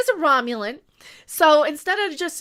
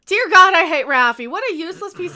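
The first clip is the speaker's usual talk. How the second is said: louder, fed-up outburst; exasperated rant; 9 dB louder than usual. Real, not acted. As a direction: spit out the hatred, loud and exasperated. Ranting about character.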